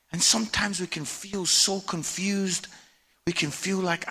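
normal talking voice

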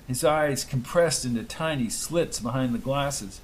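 usual speaking voice

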